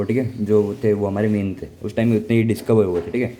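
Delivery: ordinary talk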